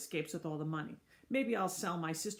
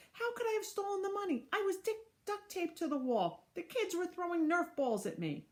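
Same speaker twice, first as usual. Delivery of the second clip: Mister Klutz, pleading; 10 semitones higher than usual. Acted.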